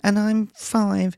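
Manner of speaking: put-on little girl voice. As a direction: play a chatty five-year-old girl. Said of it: "they were going".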